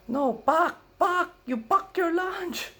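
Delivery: put-on very high, slow insistent Filipino-accented correction; grandma stressing pack, accent thick; grandma's Filipino accent. Grandma correcting the grandson's misheard word, Filipino accent. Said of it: "No, she".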